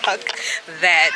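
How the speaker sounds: usual speaking voice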